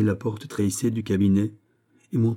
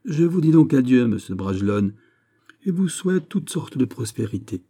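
raised, ceremonious kingly voice; formal royal farewell; 4 semitones higher, 4 dB louder than usual. Acted. King taking leave of the viscount, formal. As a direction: bid adieu as a monarch closing an audience.

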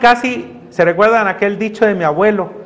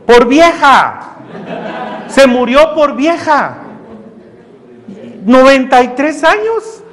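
slow, emphatic and louder; blunt answer; 5 semitones higher, 4 dB louder, slower than usual. Retold or imitated. Pastor answering the grieving sister, slow and blunt. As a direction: lay it down flatly, no comfort.

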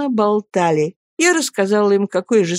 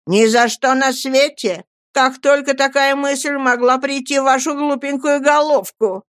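fond, emphatic scolding, pitched higher; scolding like a child; 6 semitones higher than usual. A narrator's reading. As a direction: scold her fondly as one would a little child. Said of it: "как с малым ребёнком". (ласково журит, как малого ребёнка)

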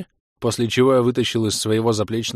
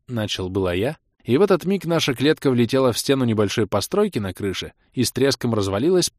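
ordinary delivery

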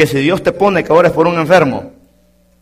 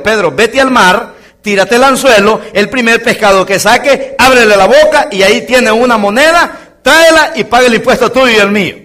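higher, louder commanding voice of Jesus; 6 semitones higher, 4 dB louder than usual. Acted. Jesus sends Peter to the sea in a commanding voice. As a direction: give the order with authority.

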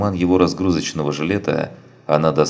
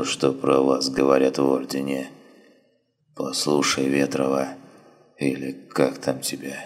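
dying wounded man; 2 semitones lower than usual. Acted. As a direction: barely get the words out, fading. Dying man speaking faintly.

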